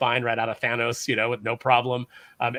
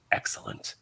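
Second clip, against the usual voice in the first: a voiceless, gloating whisper of Doom's one word; Doom's sinister whisper; 5 dB quieter than usual. Retold or imitated. Host retelling Doom's final panel, in a villain's whisper. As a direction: breathe the word out like a villain savouring his win. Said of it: "and he just goes".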